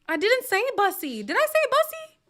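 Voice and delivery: high, loud protest; shocked denial. Real, not acted. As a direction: insist you never said it, scandalized. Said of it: "I swear I said Busey".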